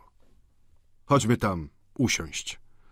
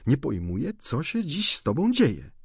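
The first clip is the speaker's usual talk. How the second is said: ordinary talk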